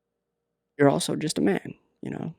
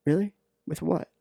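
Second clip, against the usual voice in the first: taunting; 3 semitones higher, 4 dB quieter than usual. Acted. Taunting her about the phone.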